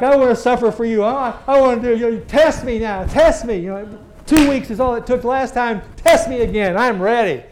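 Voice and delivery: loud, high, overeager begging; youthful zeal hammed up in prayer; mock eager prayer. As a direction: pray like a zealous young fool.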